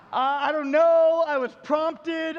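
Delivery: loud, drawn-out mock helplessness; flustered excuse. Pastor answering the shocked man, flustered. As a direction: stretch it out as a lame, helpless defence.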